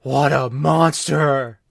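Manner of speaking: loud, slow horrified voice; outraged mock voice. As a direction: play a shocked scold. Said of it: "they're like".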